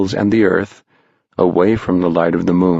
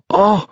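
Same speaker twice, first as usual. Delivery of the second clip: much higher, sharp shocked gasp; group gasp; 13 semitones higher than usual. Acted. Boys gasping as the pumpkin goes dark, high and sharp. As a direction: gasp as the light dies. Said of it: "gasped everyone".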